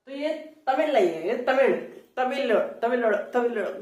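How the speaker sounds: "तमिल" with a sound between l and retroflex r; Tamil "तमिल". Shown as a demonstration. Teacher attempting the native pronunciation. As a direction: try the southern l in "तमिल". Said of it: "मैं सही उच्चारण नहीं कर पा रहा हूं".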